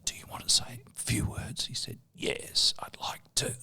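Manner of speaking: whispered question and answer; hushed, gentle exchange. Retold or imitated. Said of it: "and I just said".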